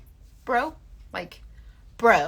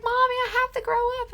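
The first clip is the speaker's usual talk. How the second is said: put-on high, quick child protest; son's voice; faster than usual. Son telling mom he must grow, high child voice. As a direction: argue like a kid.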